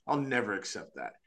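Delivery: ordinary talk, nothing marked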